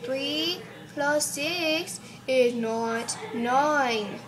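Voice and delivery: very slow, drawn-out teacherly correction. Pretend. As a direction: spell it out word by word for the class. Teacher slowly correcting the class.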